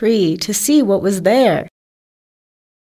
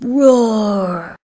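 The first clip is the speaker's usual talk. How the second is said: drawn-out spoken "roar", falling; storybook roar at talking volume; mock lion roar. A narrator's reading. Trapped lion calls out, mild mock roar. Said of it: "He roared very loudly".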